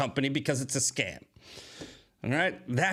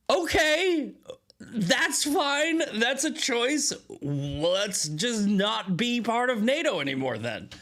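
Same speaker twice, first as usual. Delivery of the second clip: high-pitched, exaggerated "fine by me"; feigned easygoing agreement in a high voice; mock acceptance; 9 semitones higher, slower than usual. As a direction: sarcastically go along with it, voice pitched way up, then easing down. Mock acceptance of allies' refusal.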